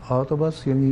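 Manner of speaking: ordinary talk, nothing marked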